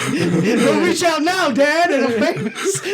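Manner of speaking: high, slow, laughing snooty voice; famous cat voice. Pretend. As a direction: drawl it out like a stuck-up star.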